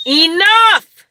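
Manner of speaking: sudden high furious yell. Real, not acted. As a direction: bellow it at the dog. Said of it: "I'm sorry I yelled".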